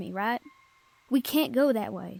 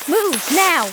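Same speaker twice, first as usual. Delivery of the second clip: loud urgent order to run; commanding shout; 5 semitones higher, 9 dB louder than usual. Acted. Adventurer ordering partner to flee the ambush, loud shout.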